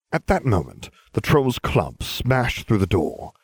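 usual speaking voice

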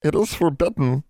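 high-pitched elf voice; high, pleading refusal; insistent house elf. A narrator's reading. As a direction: repeat the rule as a small creature. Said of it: "he repeated".

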